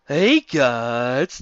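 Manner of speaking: ordinary delivery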